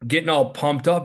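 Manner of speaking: ordinary delivery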